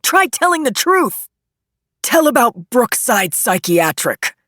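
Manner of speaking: high, loud courtroom outburst; angry yell. Acted. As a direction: yell taunts across the room at a witness. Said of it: "jumped to his feet again and yelled".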